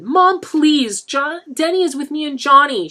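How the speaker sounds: shrill cry to stop her mother; screeched. A narrator's reading. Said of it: "she screeched".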